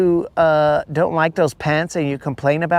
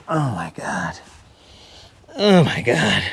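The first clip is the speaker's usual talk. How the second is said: whispered disbelief; 6 semitones lower than usual. Real, not acted. Streamer reacting to chat.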